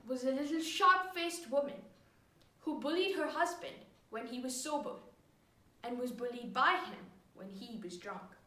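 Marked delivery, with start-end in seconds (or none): none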